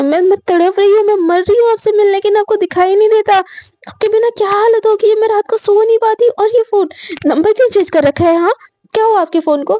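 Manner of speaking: high, pleading outburst; desperate pleading. Retold or imitated. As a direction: beg her to come back, voice high. Sakshi pleads desperately with girlfriend. (ऊँची आवाज़ में तड़पकर गिड़गिड़ाना)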